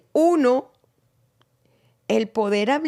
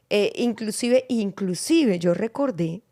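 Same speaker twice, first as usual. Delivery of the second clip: usual speaking voice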